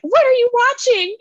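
shocked dad. Retold or imitated. Dad walks in on mom's racy show, shocked. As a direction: imitate a dad scandalised by what's on TV.

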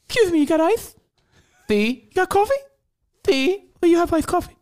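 a squeaky customer voice at the drive-thru window; high put-on customer. Host plays a customer quizzing the drive-thru worker, high put-on voice.

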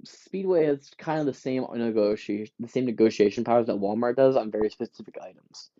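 ordinary talk

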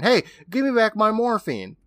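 hammed-up yell of protest; imitated yelling. Retelling Ingalls reaching for his medicine, mock yelling. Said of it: "Ingalls starts yelling and reaching".

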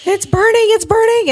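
high, loud panicked wail; frantic high shriek of pain; panicked cry. Retold or imitated. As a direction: cry it out as the eye burns. Screaming in pain, retold.

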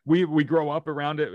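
ordinary talk, nothing marked

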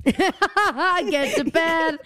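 high, drawn-out laughing repeat. Real, not acted. Co-host delighted by the comedian's line, laughing. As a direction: crack up while echoing the punchline.

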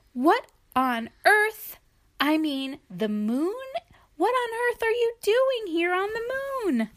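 high, incredulous "What on earth"; astonished hippo. Acted. Other hippo shocked to find Sheila, high and incredulous. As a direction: sputter in disbelief.

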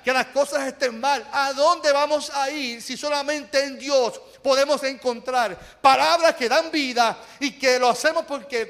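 fervent preaching. Acted. Pastor exhorting the congregation, fervent preaching. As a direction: preach it with conviction.

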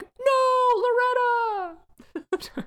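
mock wail, laughing through it; laughing, hammed-up howl of grief; hammed-up anguished cry. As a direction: cry out her name in mock despair. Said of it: "he's still like".

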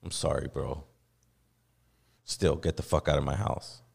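low, hollow sorry; mock apology. Host mocking an empty apology to Armando, low put-on voice. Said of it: "so I could go".

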